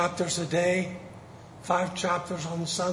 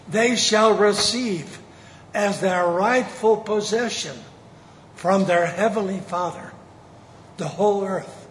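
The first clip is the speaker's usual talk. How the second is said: fervent preaching; 5 dB louder than usual. Acted. Preaching climax.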